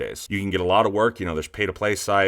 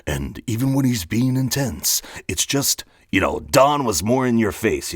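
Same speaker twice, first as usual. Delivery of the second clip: movie trailer voice; 3 semitones higher, 4 dB louder than usual. Shown as a demonstration. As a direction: announce it like a film trailer. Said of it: "my movie trailer voice".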